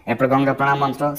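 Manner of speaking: ordinary delivery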